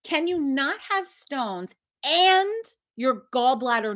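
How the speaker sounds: high, stretched question, weighing each word; slow, spelled-out asking. Retold or imitated. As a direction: spell it out very slowly for him. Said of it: "I had to ask him".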